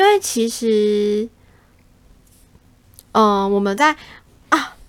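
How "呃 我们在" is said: normal talking voice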